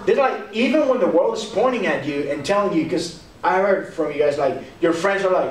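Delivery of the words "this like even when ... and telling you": ordinary talk, nothing marked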